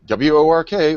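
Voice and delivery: W O R K spelled out loud. Real, not acted. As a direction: spell it out with mock horror.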